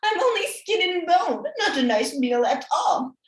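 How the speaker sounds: high, loud pleading granny. Acted. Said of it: "begged grandmother".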